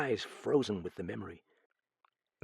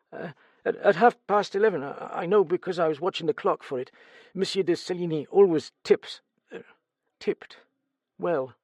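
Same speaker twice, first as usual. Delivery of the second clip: stammering, higher, louder frightened servant; nervous stammer; 8 semitones higher, 3 dB louder than usual. Acted. Steward questioned about the murder, nervous stammer. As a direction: stumble over the words in fright.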